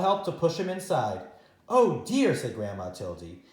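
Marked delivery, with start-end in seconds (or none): 1.7-2.4 high, loud fretful oh dear; worried oh dear. Acted. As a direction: fret aloud at the problem. Grandma worried.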